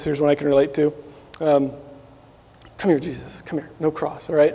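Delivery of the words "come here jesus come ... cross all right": hushed coaxing. Retold or imitated. Disciple quietly beckoning Jesus to step aside, hushed and sly. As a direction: coax him over quietly, like a schemer.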